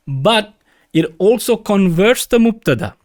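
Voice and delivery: ordinary delivery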